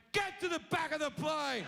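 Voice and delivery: gloating command; triumphant order. Retold or imitated. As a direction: order him to the back like a victor. Pointing, ordering man away.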